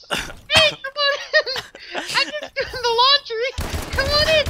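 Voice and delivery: put-on very high-pitched decoy girl. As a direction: chirp it high, calling from inside. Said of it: "She's like".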